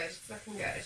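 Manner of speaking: soft echo, half to herself; quiet repeat to herself. Real, not acted. Repeats phrase softly to herself.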